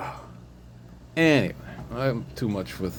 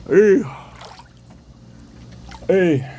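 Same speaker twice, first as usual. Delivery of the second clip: sharp loud high hey; sudden call out; 10 semitones higher, 7 dB louder than usual. Real, not acted. Calling out to someone nearby, loud. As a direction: call out to get attention.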